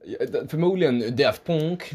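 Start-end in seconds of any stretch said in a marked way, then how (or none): 1.2-1.9 mock French accent, "deff ponk". Podcaster guesses how the French band says its name, French accent. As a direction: say it as a Frenchman, slowly, slightly raised. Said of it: "de är frans".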